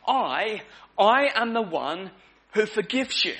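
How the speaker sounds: repeated "I" given weight, with a pause after the first; strong emphasis on I. Acted. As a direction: lean hard on each "I" in God's declaration. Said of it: "notice the emphasis, the strong emphasis on the word "I".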